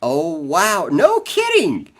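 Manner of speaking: high, loud amazed reaction; surprised. Real, not acted. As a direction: shout out in surprise. Host reacts to news.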